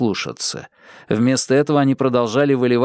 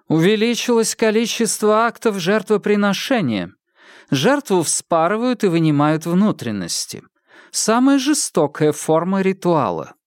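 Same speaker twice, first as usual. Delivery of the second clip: woman character voice; 8 semitones higher than usual. Acted. Woman speaks of sacrifices.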